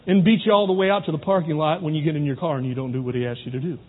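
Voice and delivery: ordinary delivery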